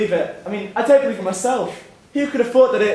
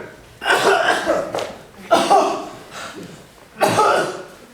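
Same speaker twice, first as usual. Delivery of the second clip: coughing fit; 8 semitones higher than usual. Acted. Old man wheezes after racing.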